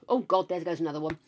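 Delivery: ordinary talk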